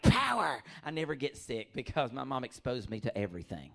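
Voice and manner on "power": a loud, high cry of power; triumphant shout. Retold or imitated. A comic boasting of immunity, loud and high. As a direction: yell it like a superhero. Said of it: "you're like".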